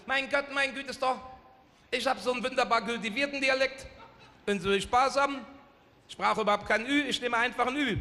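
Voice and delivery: hohes, gedehntes Sächsisch. Retold or imitated. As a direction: Sachsen parodieren. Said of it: "der Sachse, der sagte". (Sachse dankt Gott, hoch auf Sächsisch)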